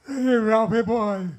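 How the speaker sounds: loud, drawn-out catchphrase call; Norton. Acted. Comic recalling his sitcom co-star, booming catchphrase call. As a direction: greet him with a big, slow hail. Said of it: "Norton, when I think that I work with".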